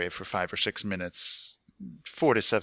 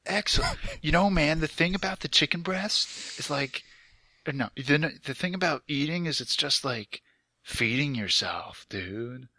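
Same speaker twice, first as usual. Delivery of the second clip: higher-pitched, hammed-up dude accent; Ted; 4 semitones higher than usual. Host joking about feeding yourself, bad Ted accent. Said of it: "where'd Ted go?".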